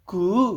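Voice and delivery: high drawn-out infant coo; baby coo. Retold or imitated. Teacher showing students a newborn's first sound, cooing. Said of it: "बच्चा क्या करता है".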